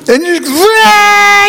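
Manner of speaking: high hammed-up whine of a punished child; mock child wail. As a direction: wail like a kid being put on a chair. Child wailing at discipline.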